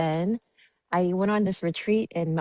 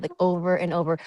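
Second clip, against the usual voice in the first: usual speaking voice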